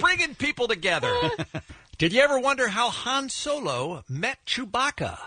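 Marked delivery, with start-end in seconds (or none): 1.2-1.7 high laugh. Real, not acted. Host laughing after joke.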